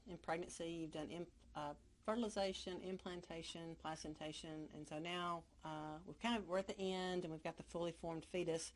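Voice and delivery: normal talking voice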